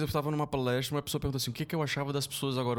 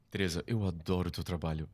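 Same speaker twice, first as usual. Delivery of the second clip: lower, put-on fan voice; voz de fã forçada; 9 semitones lower than usual. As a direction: fake a deep polite fan voice. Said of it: "Eu fiz uma voz mesmo". (voz de fã, mais grave)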